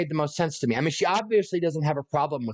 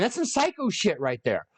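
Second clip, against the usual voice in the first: ordinary talk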